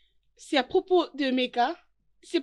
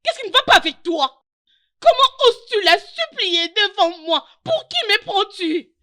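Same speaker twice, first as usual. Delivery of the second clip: loud, higher-pitched angry challenge; outraged confrontation; 6 semitones higher, 6 dB louder than usual. Acted. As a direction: confront him, voice raised in outrage. Bride confronts partner in quarrel. (indignation furieuse, voix forte)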